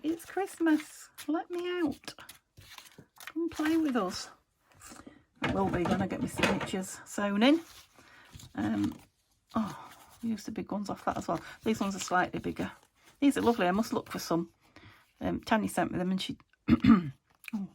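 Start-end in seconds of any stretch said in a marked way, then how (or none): 0.0-4.3 high, slow squeaky little voice; playful toy voice. Pretend. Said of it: "They're like". 16.7-17.7 clearing dust from the throat; throat caught on dust. Real, not acted. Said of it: "I've got some dust in my throat".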